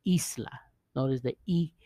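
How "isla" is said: isla as "EES-la", first syllable higher; stress on first syllable. Shown as a demonstration. Teacher explaining the accent, isla stressed "EES-la". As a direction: hit the start of isla, pitched up. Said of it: "the emphasis in the I S".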